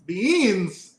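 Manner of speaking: "Beans" stretched long with a firm B; over-enunciated. Shown as a demonstration. Husband proving his pronunciation, "Beans" over-enunciated. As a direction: sound out the B and E in "Beans", drawn out. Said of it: "It's a B and an E".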